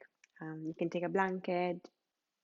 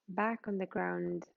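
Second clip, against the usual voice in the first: guided meditation; 4 dB quieter than usual. Real, not acted. Yoga teacher settling students lying on the floor, guided meditation. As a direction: guide them gently into rest, leaving space between phrases.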